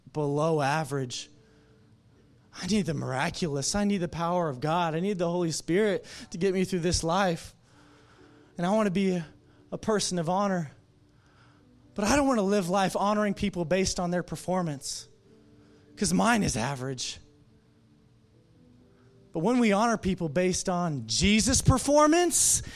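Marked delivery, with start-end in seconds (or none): 2.6-17.2 louder, higher sermon build; rising preacher's fervor; preaching. Acted. 19.3-22.6 louder, higher, pounding the line; full-voiced climax of the sermon; preaching at full force.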